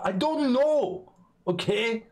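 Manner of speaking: high, drawn-out cry of exasperation; exasperated outburst. Real, not acted. Streamer answering why he always gets bad beans, exasperated. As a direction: protest it high and stretched out.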